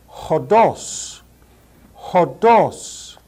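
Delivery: hodos as HO-doss with breathy h; hodos, h sound up front; hodos, Greek demo; rough breathing h. Shown as a demonstration. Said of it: "Notice the rough breathing mark".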